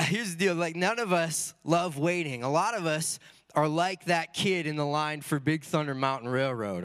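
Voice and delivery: ordinary talk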